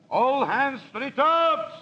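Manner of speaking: high, slow, ringing call across the deck; shouted orders. Acted. First mate calling orders to the whole crew, a long high shout. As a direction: bellow the commands out to the crew.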